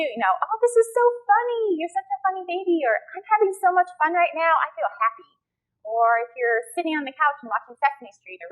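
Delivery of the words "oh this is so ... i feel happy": put-on high, delighted talk to a baby; happy. Shown as a demonstration. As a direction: share the fun with a baby. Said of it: "label happy emotions too".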